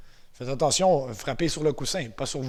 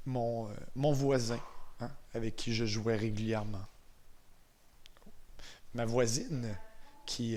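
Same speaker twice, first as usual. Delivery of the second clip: guided visualization; 6 dB quieter than usual. Real, not acted. Instructor guiding eyes-closed memories of first snow, calm and unhurried. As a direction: ease them softly into a memory.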